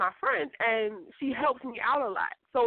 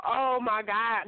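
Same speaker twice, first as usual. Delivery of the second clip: usual speaking voice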